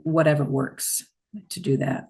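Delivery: ordinary talk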